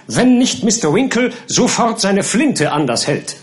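firm, high-pitched refusal; resolute. A narrator's reading. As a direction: put your foot down indignantly. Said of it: "erklärte der Gelehrte entschlossen".

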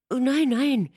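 high, loud protest; emphatic denial with leaping pitch; eager denial. A narrator's reading. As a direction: rush to deny it brightly. Catherine hastily reassures her visitor.

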